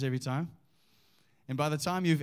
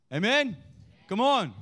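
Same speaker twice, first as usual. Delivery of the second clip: higher, urging call for an amen; rousing call; 6 semitones higher, 3 dB louder than usual. Real, not acted. Rousing congregation for a response.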